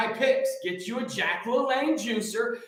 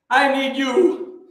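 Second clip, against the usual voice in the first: emphatic appeal; 4 semitones higher, 6 dB louder, slower than usual. Real, not acted. Rallying listeners after list.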